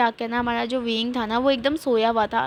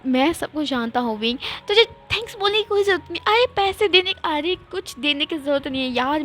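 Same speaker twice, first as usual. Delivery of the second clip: lightly put-on friend voice, mock-teary and gushing; a hint of a sobbing, sentimental buddy; teary friend Shuyang; 3 semitones higher than usual. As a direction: gush like an overly moved best friend. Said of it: "तो शुयांग बोलता है".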